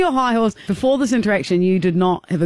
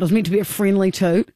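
ordinary delivery

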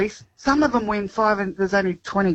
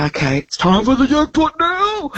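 high, excited jackpot call; jackpot announcement; 10 semitones higher than usual. Retold or imitated. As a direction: announce it like a bingo caller. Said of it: "and then you go".

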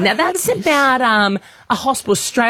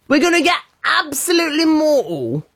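put-on lightly done Geordie accent, high; a hint of a Geordie party lad; 7 semitones higher than usual. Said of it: "give us a Geordie accent".

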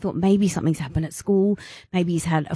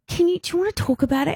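gentle offer; 10 semitones higher, faster than usual. Retold or imitated. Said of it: "And I'm like".

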